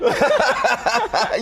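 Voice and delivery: high delighted burst of laughter; laughing. Real, not acted. Host cracking up at the guest's greeting. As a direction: laugh out loud, high and free.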